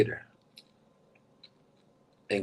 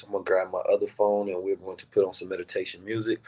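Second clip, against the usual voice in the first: usual speaking voice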